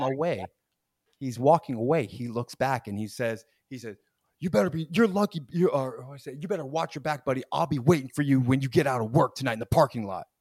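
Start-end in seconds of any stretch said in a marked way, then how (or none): 4.4-10.3 louder, menacing retold threat; mock threat. Imitating the angry customer threatening the clerk, mild mock menace. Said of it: "my life was just threatened".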